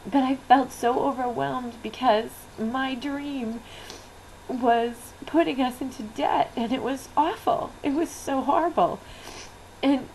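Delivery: real tears rising, voice lifting and straining; fighting back tears while telling it; choked up. Real, not acted. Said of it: "you can see how emotional I'm getting now".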